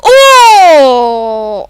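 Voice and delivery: loud long goal shout; joyful yell. Real, not acted. Gamer cheering a goal, loud joyful yell. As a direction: let it ring out.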